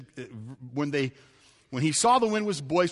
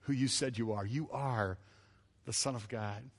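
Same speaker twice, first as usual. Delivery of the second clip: lower, softer reverent confession; hushed worship; 6 semitones lower, 9 dB quieter than usual. Retold or imitated. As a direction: speak it in quiet awe. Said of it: "fall at his feet and say".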